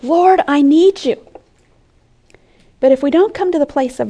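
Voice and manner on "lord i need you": raised, slow, louder plea; heartfelt cry for help, drawn out and high; earnest desperation. Acted. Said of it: "out of the earnestness and desperation of our hearts".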